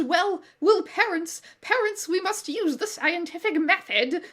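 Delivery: put-on high, slow prissy child voice; high know-it-all boy; annoying child genius. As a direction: play a know-it-all kid lecturing his parents. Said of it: "annoying child genius Harry talks like that".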